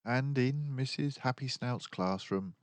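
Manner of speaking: plain reading voice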